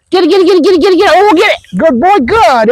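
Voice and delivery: high-pitched egging on; high play chant to a dog; excited urging. Real, not acted. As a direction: rile the dog up in a high, eager voice. Urging dog to chase toy.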